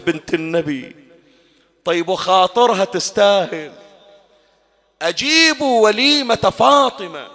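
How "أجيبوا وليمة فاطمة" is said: slow, loud and high public call. Retold or imitated. Imam Ali calling Medina to Fatima's wedding feast, loud drawn-out cry. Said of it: "وصاح".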